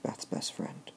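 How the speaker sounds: whispered aside, barely voiced; breathy stage whisper to the class. Shown as a demonstration. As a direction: lean in and whisper it like a secret. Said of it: "teacher repeats line for emphasis".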